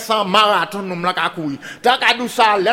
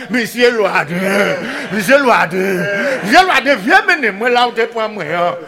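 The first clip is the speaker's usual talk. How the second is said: pig's voice; 4 dB louder than usual. Acted. Pig haunting Loaden's dreams, wailing his name. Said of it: "comme si du voix cochon".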